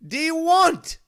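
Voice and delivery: high, loud, slow exasperation at the dog; exasperated yell. Retold or imitated. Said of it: "And I'm like".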